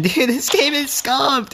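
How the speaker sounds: laughing high and slow. Real, not acted. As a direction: laugh it out, amused. Laughs at broken game.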